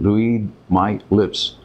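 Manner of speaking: "Read my lips" spelled out word by word, very slow; slow deliberate stress. Shown as a demonstration. To critics doubting his citizenship, "Read my lips" slow and deliberate. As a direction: hammer each word of "Read my lips" slowly. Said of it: "this is what I'm gonna tell you".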